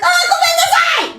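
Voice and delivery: very high squealed reply; mock panicked yelp. Acts out own panicked reply.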